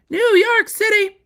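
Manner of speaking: New York City squeaked very high and slow; a high, drawn-out jab at New York City; mock New York scorn. Jabbing at New York City in a high, slow squeak.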